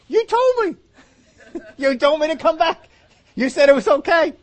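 high-pitched, loud cry of betrayal; indignant protest. Acted. Doomed prophet protesting to the lying old prophet, high indignant cry. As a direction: squeal out the unfairness of it.

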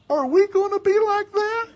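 very high, loud, hammed-up wail; mock crying. Girlfriend upset after seeing parents wrestle, mock tearful wail. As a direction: imitate a tearful girlfriend wailing. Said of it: "she starts crying".

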